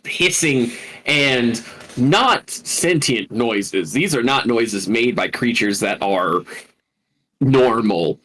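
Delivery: normal talking voice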